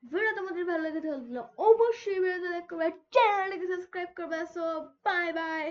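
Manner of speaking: loud, emphatic outro to viewers; loud sign-off. Real, not acted. Gamer asks viewers to like and subscribe, loud sign-off. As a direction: project the goodbye with big energy.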